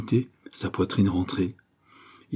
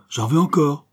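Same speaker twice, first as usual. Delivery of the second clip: higher, eager exclamation; eager demand; 7 semitones higher, 5 dB louder than usual. Acted. Returning guest greeting the writer, eager. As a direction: greet them hungry for more.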